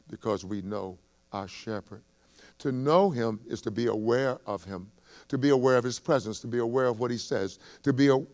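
ordinary delivery

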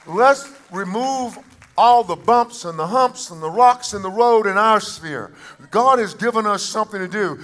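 high-pitched, forceful declaration; full-force preaching. Acted. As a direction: preach it out to the whole congregation.

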